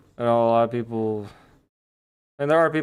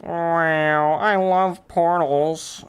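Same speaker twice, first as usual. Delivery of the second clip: higher, drawn-out silly gushing; odd mock enthusiasm; 6 semitones higher, 4 dB louder, much slower than usual. Streamer gushes about loving portals, silly and drawn-out. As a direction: gush it slowly in a silly high voice. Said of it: "I don't know why I said it like that".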